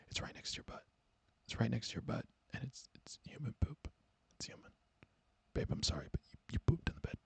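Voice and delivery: hushed, apologetic whisper; gentle boyfriend. Acted. Boyfriend gently shows girlfriend the evidence, whispering. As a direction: whisper it as delicately as possible. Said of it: "He's like".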